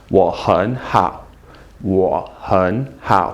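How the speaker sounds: low, slow "Wo hen hao"; slow model phrase. Shown as a demonstration. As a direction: sound out Wo, hen, hao for learners. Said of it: "Say that with me".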